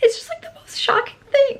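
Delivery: very high, squeaky fake crying; hammed-up wail of disbelief; mock-tearful shock. Vlogger joking about shocking news, mock-tearful. Mock emotion over joke.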